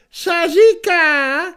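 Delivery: put-on high, loud, slow cracked old voice; a deaf ancient straining to hear; old man croaking. A narrator's reading. Ancient deaf Humoš asks. (pomalý, vysoký stařecký hlas)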